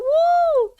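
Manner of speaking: high, celebratory "woo"; excited cheer. Real, not acted. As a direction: whoop it up for their good news. Cheering a viewer's house news.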